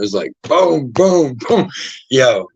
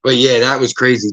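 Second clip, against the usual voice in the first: usual speaking voice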